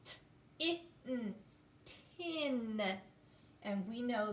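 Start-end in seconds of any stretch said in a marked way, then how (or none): 0.1-2.8 T, I, n then blended into Tin; sounding out. Shown as a demonstration. Teacher repeating the sounds, then blending. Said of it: "Again, make the sounds".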